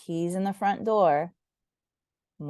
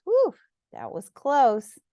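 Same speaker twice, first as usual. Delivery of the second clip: relieved whew; 7 semitones higher, slower than usual. A narrator's reading. Relief after near fall.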